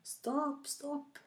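high, drawn-out plea to stop; desperat rop. A narrator's reading. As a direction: cry out "stop" in a high acted voice. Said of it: "Han ropte". (lyst, langtrukkent rop)

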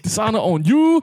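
high booming curse; dramatic movie quote. Pretend. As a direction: proclaim it like a curse. Echoing movie line, far.

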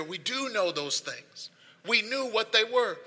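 ordinary talk, nothing marked

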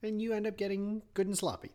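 ordinary talk

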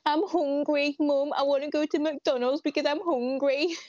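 exaggerated Brummie accent, pitched well up; May's Brummie accent. Shown as a demonstration. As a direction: impersonate a hungry Brummie pleading with Mum. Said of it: "what does May always say".

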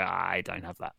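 quiet, deadpan host with a laugh; mock flat refusal. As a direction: drop it low and dry. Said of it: "they might go".

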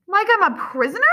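high, incredulous question; loud protest rising in pitch; disbelief. A narrator's reading. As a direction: throw it back, unable to believe it. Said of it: "he asks in disbelief".

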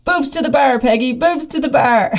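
high-pitched mock shout back; imitated shout. Husband ahead on steep hill shouting back to his wife, imitated shout. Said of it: "he'll shout back".

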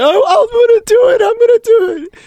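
squealed falsetto, very loud; mock hysterical threat. Character turns gun on himself, very high mock hysteria. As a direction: shriek it as a hammed-up movie threat. Acted suicide threat in film scene.

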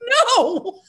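high, loud, comic holler; retold drawn-out shout; mock yelled reply. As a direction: bellow the answer back down, laughing at yourself. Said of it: "And I go".

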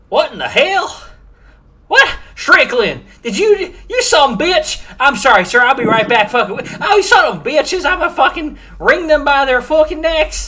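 high, loud, furious little gnome ranting; angry gnome woman. Acted. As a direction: shriek at them like an outraged tiny old gnome. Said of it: "She looks at you and goes".

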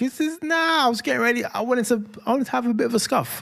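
high-pitched mock protest; imitating a disappointed brother. Dad voicing a boy robbed of his scuffle, mock indignant. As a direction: complain in a high, put-on kid voice. Voices brother wanting a fight.

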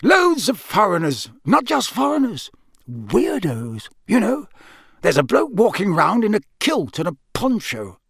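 high-pitched local, musing and wary; Mister Roberts. Acted. Campsite keeper describing strange guests, high-pitched. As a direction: list the oddities in a high character voice.

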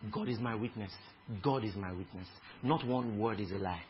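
low, soft aside in another's words; understated, hushed swearing of truth; retold solemn oath. Retelling cousin's astonished phone reply.

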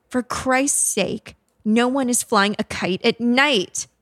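high, emphatic outburst; exasperated shout. A narrator's reading. Killer snaps at ghost boy, exasperated shout. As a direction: stretch the oath, then scold in exasperation. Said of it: "Douglas shouted".

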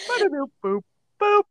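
playful beeping alien noises. Pretend. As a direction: boop it out like a cartoon alien. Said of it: "it's alien noise time".

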